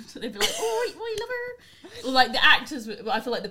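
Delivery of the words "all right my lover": high, slow, hammed-up Bristolian accent. Recalling the Skins parents, an exaggerated slow Bristolian accent. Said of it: "they would have a funny accent".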